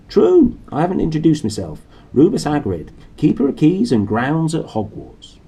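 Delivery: high, loud hearty giant introducing himself; booming Hagrid. Acted. Hagrid introducing himself to Harry, loud and hearty. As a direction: announce yourself with gusto.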